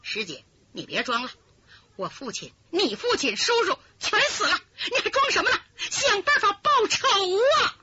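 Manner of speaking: high, tearful crying outburst; half-sobbing accusation; grieving woman unmasking the impostor; anguished outburst. Acted. Daughter exposes her false sister.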